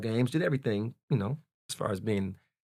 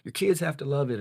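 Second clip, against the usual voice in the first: higher voice of other people; imitating outside chatter; 9 semitones higher than usual. Outsiders telling the son to continue the legacy, mimicked. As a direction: lightly mimic nagging outsiders. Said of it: "chatter coming in".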